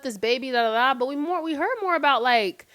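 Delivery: ordinary delivery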